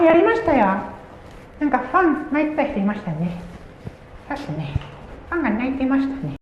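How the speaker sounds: high put-on voice for the other streamer's reply; imitating Rin. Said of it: "って言ったら".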